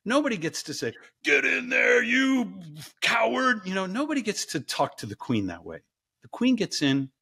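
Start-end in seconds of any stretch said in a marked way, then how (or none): 1.2-3.6 high, drawn-out drill-sergeant shout; mock yell. Bully yelling at the queen by the ice bath, mock shout. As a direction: bellow a bullying order, pitched up. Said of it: "Nobody gets to yell at the queen".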